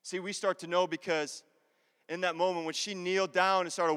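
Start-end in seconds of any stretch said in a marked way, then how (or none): none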